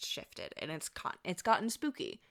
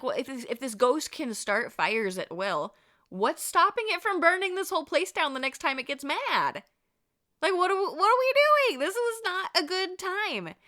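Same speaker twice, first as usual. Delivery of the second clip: higher, louder, building panic; acted freak-out; 9 semitones higher, 6 dB louder than usual. Storyteller voicing Tony fearing the ghost's fires, loud mock panic. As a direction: play him worked up and protesting. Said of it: "this really, really freaked him out".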